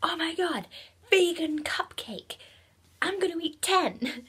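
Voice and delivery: high, loud giddy squeal over a cupcake; excited gushing. Retold or imitated. Vegan vlogger gushing over a vegan cupcake, giddy squeal. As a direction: gush like a kid spotting a treat. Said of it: "because I'm like".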